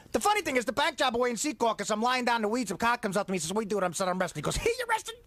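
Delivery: breathless, high wiseguy rant; Joe Pesci impression. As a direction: talk out of breath like a mob guy. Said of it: "The way to do a Joe Pesci impression".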